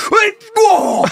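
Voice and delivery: high, loud protest; mock outrage. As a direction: yell it in hammed-up disbelief. Acted outrage at charges.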